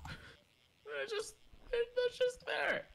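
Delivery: high, whining voice; whiny complaint. Real, not acted.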